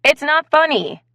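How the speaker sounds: irritated snapped rebuke. Acted. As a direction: bite the words off angrily. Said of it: "Blaze snapped".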